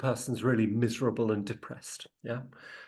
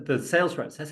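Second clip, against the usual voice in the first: usual speaking voice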